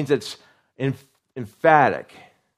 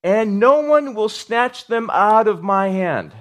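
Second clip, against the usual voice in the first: high, louder and slow, every word weighted; emphatic declaration; 9 semitones higher, 7 dB louder, slower than usual. Acted. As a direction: declare it slowly like a promise. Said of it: "if you really want to say something".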